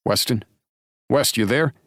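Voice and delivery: sharp call, rising up high into the phone; cracking like a whip. Acted. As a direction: snap his name out to wake him. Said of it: "Drake's voice cracks like a whip".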